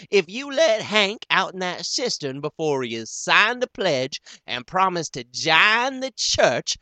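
high, loud, firm pronouncement; right decided. Acted. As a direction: Miss Primrose lectures Elmira on taking a stand. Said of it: "she says right decided".

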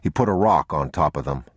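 ordinary delivery, nothing marked

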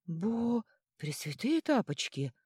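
stunned, voice just returning; gasped exclamation. A narrator's reading. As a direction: start high, then slow in astonishment. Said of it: "ахнула прорезавшимся голосом Вителья". (ахнув от изумления)

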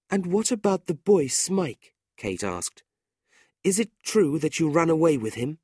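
high, urgent plea; begging. A narrator's reading. Kate begs her angry brother, high and urgent. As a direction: beg him gently but urgently.

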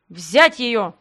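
a loud barked command; barked order. Acted. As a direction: bark it at the servants. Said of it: "рявкнул Аурватор дер Касар".

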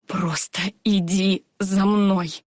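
«Просто иди за мной!» every word slow and separate; each word stressed. A narrator's reading. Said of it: "выделил он каждое слово".

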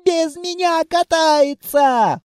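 high, drawn-out indignant wail; indignant child's cry. A narrator's reading. Child of darkness left out of the ride, indignant cry. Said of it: "возмущённый крик дитя тьмы".

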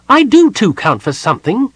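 high, loud protest from a small kender; high-pitched, affronted little character; offended kender. A narrator's reading.

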